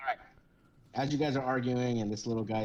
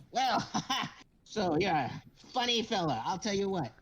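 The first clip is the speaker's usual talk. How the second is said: higher, self-important little captain; pompous kobold captain; 7 semitones higher than usual. Acted. Kobold captain boasting to the crew, high and pompous. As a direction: puff up like a tiny captain in a big hat. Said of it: "he continues to tell you".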